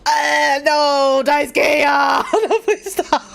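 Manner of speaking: loud, high wailing plea; distressed wail. Real, not acted. Player mourning a lookalike character, distressed wail. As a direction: wail it out, half laughing, half crying.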